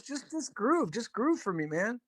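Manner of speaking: lightly imitated musician, high and soft. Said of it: "Prince is like".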